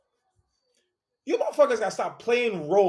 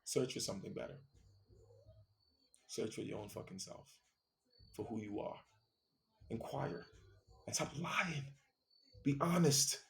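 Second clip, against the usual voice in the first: much softer, almost hushed, turning inward; soft closing plea; 3 semitones lower, 17 dB quieter than usual. Real, not acted. To listeners searching for themselves, hushed and earnest. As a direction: let the rant die into a hushed, sincere plea.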